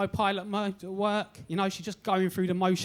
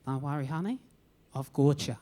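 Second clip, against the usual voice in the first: reassuring; 9 semitones lower than usual. Retold or imitated. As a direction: soothe him like a kindly receptionist. Receptionist reassuring shy patient.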